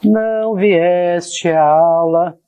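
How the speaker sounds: slow, drawn-out words with long pauses; stretched syllables, long gaps between phrases; slow dictation. Shown as a demonstration. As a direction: say it piece by piece as you write it out. Dictates slowly while writing it out.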